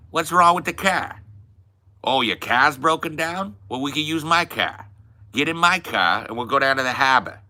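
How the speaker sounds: put-on harsh Boston accent with dropped r's. Rounding up the boys to the harbor, Boston accent. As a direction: put on a rough-and-ready Boston townie accent. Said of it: "can't really do a good Boston accent".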